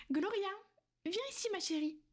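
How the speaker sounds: high call across the room to Gloria. Acted. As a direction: summon her sweetly from afar. Calling servant over.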